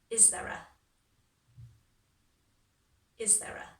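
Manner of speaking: "Is there a" joined into one quick unit; linked words. Shown as a demonstration. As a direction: model "Is there a" with no gaps. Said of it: "Try that bit after me".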